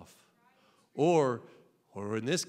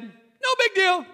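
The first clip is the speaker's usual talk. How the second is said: mock casual Lord; 8 dB louder, slower than usual. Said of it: "And the Lord said".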